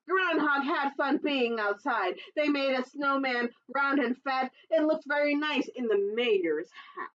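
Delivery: ordinary delivery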